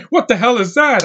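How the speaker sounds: high, loud barked impression; loud mock outburst in an actor's manner; tough movie-detective voice. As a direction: bark it like a badass cop. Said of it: "I like the way he talks".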